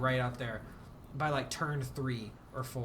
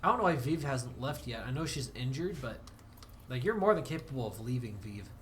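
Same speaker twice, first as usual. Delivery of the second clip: usual speaking voice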